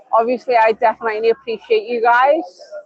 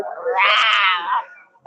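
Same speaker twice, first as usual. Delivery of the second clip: lower, softer drawn-out "row"; imitated cry; 5 semitones lower, 5 dB quieter than usual. Random old Spanish lady came up, imitated low cry. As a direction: demonstrate her cry at lower pitch. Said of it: "Like that".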